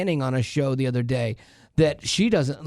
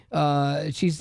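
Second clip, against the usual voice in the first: normal talking voice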